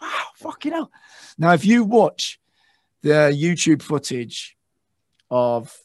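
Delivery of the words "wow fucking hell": awed. Retold or imitated. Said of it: "I'm like".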